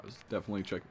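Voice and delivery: usual speaking voice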